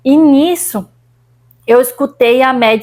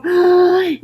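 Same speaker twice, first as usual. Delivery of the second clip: gasp; 7 semitones higher than usual. Retold or imitated. Retelling gasping for air.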